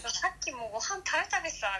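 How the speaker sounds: a hint of Mom scolding in Hokkaido dialect; mother's Hokkaido dialect. Mother tells her daughter she already ate, in light Hokkaido dialect. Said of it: "母に言われたり".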